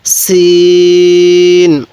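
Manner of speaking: সিন held very long, thin and firm s; thin hard sin. Shown as a demonstration. Teacher models Arabic sin, thin and hard, very slow. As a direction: draw out সিন with a thin sharp s. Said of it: "সিনের আওয়াজটা একটু চিকন করে শক্ত করে".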